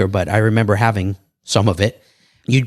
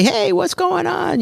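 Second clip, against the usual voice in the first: a breezy hello pitched way up and slowed; high casual greeting; slower than usual. Retold or imitated. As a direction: play it light and friendly, far above your normal voice. Retold greeting to mafia neighbour.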